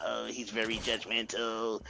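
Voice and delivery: slow, quiet tipsy complaint; imitated drunk sister. Drunk sister complaining to his wife, imitated slow grumble. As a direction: mimic a drunk grumble, drawn out and soft. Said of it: "one of these days she was drunk".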